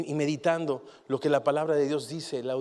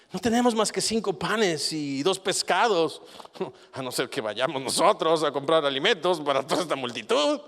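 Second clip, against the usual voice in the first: sarcastic intonation; 2 semitones higher, 5 dB louder than usual. Shown as a demonstration. Preacher showing the disciples' line with sarcastic intonation. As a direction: lay on the sarcasm, almost laughing. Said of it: "Yo le pondría esta entonación".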